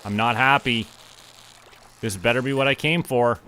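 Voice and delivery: ordinary talk, nothing marked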